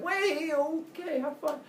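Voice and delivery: high, quick wait, okay, have fun; high-pitched resigned goodbye; hasty send-off. Acted. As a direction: wave him off in a high voice. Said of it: "And he's like".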